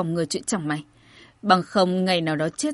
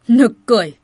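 loud, indignant dismissal; forceful, defiant retort; scornful outburst; 7 dB louder than usual. A narrator's reading. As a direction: scoff loudly at the excuse. General scornfully rejects messenger's excuses.

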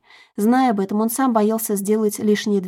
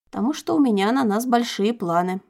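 ordinary delivery, nothing marked

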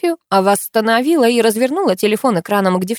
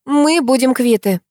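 higher, steady and firm; 6 semitones higher, 4 dB louder than usual. Acted. Narrator tells friends they will be even, firmly. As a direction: settle it, no room for argument. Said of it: "твёрдо ответила я".

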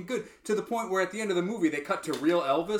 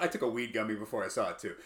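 ordinary delivery

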